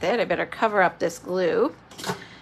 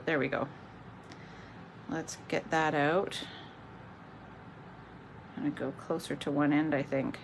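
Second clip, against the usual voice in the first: quiet murmur, focused on the task; trailing off; 4 semitones lower, 10 dB quieter than usual. Real, not acted. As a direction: voice fading while concentrating. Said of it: "my voice trails off a little bit when I get concentrated".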